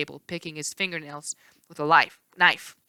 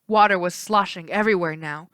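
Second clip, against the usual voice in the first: usual speaking voice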